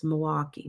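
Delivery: local "Muh-waukee", no L in "Milwaukee"; L dropped. Shown as a demonstration. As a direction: say "Milwaukee" softly with the L swallowed. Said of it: "They don't ever say the L".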